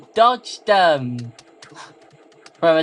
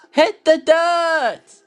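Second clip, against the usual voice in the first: dramatic command; 10 semitones higher, 3 dB louder, slower than usual. Pretend. Game command shouted dramatically.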